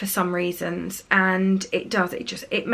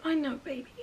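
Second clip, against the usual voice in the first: much softer, hushed consoling; tender comfort; 4 semitones higher, 11 dB quieter than usual. Retold or imitated. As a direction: murmur it like a hug. Mum comforting daughter in playground.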